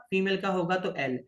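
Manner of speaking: ordinary talk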